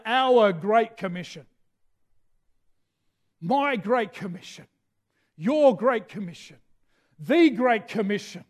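"our", "my", "your", "the" stressed in turn before Great Commission; stress on the first word. Shown as a demonstration. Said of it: "it's four times in the Bible".

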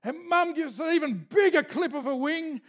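ordinary delivery

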